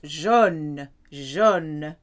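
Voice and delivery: Jaune like John in a French accent, loud and drawn out. Shown as a demonstration. Teacher demonstrates a colour word, loud French-accented Jaune. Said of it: "like saying John with a funny French accent".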